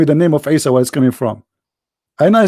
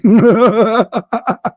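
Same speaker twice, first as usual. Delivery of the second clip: real burst of laughter; 6 semitones higher than usual. Real, not acted. Speaker laughing at the chat room's gullibility. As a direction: laugh out at the joke. Laughing at his own story.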